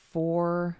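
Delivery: ordinary delivery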